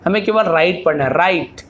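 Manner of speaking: ordinary talk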